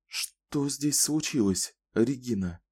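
soft, slow and kind; gentle. A narrator's reading. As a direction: ask softly, reassuring her. Kahlan gently asks.

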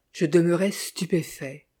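usual speaking voice

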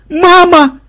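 very high, loud cry; startled yell. A narrator's reading. Looter attacked by granddaughter, yelling in surprise. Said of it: "удивлённо орал мародёр".

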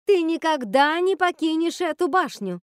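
high, loud screamed decree; furious yell. A narrator's reading. Witch forbidding Rapunzel to leave the tower, furious yell. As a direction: scream it as a final ban. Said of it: "завопила Готель".

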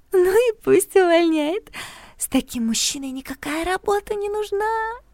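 put-on high, slow dreamy girl voice; lovestruck giggling girl. A narrator's reading. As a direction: swoon over the man.

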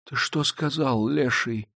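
slow, soft, dangerous hiss; hushed, drawn-out menace; menacing hiss. A narrator's reading. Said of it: "зашипел Прокофий".

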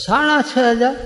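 high rising disbelief at સાડા છ હજાર; shocked echo. Retold or imitated. Husband echoing his wife's dress price in high disbelief. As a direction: repeat the price as if you can't believe it.

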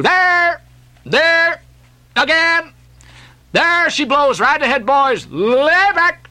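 high, held lookout cries; calling out. A narrator's reading. Flask to his crew as whale blows, calling out high. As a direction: sing out the whale sighting.

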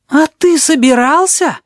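high, slow, stunned gasp; gasping in shock. Acted. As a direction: gasp it out in disbelief. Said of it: "ахнул Табаки".

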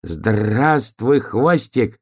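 exclaimed hello with rolled r; called-out greeting. A narrator's reading. Donkey greeting hare outdoors, calling out. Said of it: "крикнул он".

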